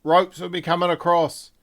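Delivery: loud, high call across the deck; captain shouting to the dock; yelling out. Acted. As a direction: shout the orders to the crew. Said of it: "just yells out".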